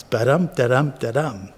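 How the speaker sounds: Ba-dum, da-dum, da-dum with weak-strong beats; da-DUM da-DUM sing-song; iambic rhythm. Shown as a demonstration. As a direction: tap out the iambic pulse. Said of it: "the flow of iams".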